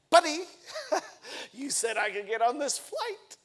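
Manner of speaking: laughing in disbelief, pitch jumping up; a half-laughing protest that he was promised the seat; annoyed laugh at the gate agent; exasperated laugh. Retold or imitated. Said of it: "And I said".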